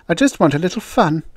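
high-pitched, pouty complaint; acted petulance; petulant. A narrator's reading. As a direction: whine a little, with a pout. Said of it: "the blonde said petulantly".

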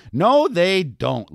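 slow, high drawn-out madam; declaring. Acted. Lulu declaring to Betty, slow and emphatic. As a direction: draw it out firmly. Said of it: "Lulu declared".

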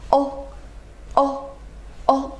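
very short, rounded "哦"; short vowel demo. Shown as a demonstration. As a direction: give "哦" as a brief isolated vowel. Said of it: "这个发音是非常的短促的". (非常短促地发“哦”)

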